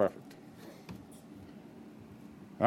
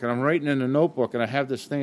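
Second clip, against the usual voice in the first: ordinary talk, nothing marked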